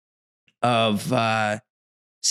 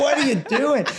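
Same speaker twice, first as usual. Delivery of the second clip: incredulous shout; 12 semitones higher than usual. Retold or imitated. Retold street fight over beer.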